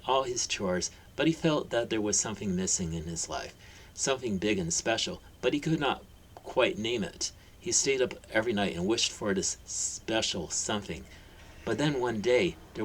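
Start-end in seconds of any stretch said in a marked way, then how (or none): none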